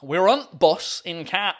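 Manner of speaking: slow attempted Yorkshire; drawn-out Yorkshire dialect; Yorkshire accent. Shown as a demonstration. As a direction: demonstrate broad Yorkshire with "the" swallowed. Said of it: "try to do a Yorkshire accent".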